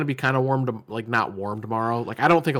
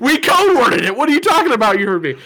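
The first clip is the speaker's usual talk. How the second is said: high, loud mock outrage; hammed-up disbelief at a friend; 10 dB louder than usual. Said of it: "I go".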